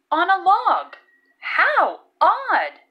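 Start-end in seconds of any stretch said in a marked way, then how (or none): 1.4-2.8 higher, louder puzzled exclaim; bright wondering exclamation; surprised "how odd". Acted. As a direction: marvel at the odd sight. Todd remarks on odd sight.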